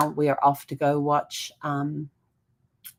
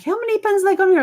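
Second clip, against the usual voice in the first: much higher mock husband voice, louder; imitating a puzzled husband, pitched way up; husband's question; 6 dB louder than usual. Husband voiced in retold story.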